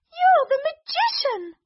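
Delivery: very high accusing cry; shocked princess. Acted. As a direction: gasp out the accusation.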